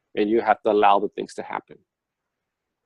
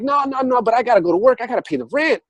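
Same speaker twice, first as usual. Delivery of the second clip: much higher whiny protest; 11 semitones higher than usual. Retold or imitated. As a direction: whine back that rent is due. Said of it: "And I'm like".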